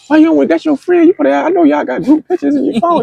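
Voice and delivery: high-pitched, re-enacted protest; pleading protest. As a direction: argue your case in a high, insistent voice. Acted protest to the friend.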